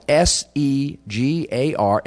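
slow letter-by-letter spelling; spelled out. Shown as a demonstration. Lecturer spelling an old word for the audience, spelled out slowly.

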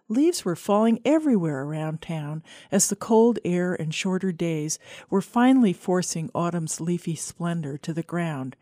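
plain reading voice

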